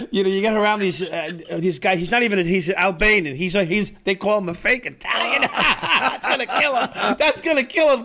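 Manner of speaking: usual speaking voice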